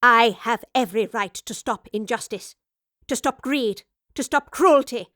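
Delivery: the professor spits back at the gunman, angrily; spat. Acted. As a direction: spit each line harder. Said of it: "the professor spat".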